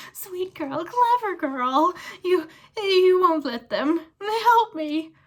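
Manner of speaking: slow, high, loud whine; fawning plea. Acted. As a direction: wheedle the girl for help. Pettigrew begs Hermione.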